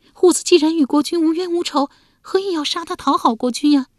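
put-on young wife voice. Wife demanding why her husband would kill their son, indignant. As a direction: press him with indignant questions. Wife's voice.

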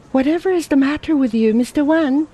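put-on a concerned young woman asking fast; Ada's voice. A narrator's reading. Ada questions Lin Fo.

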